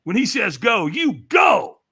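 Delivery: loud, high urgent command; imitated hype-man urging, rising to a shout; intense urging. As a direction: build to a shouted order. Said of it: "he whispers in my ear, and he says".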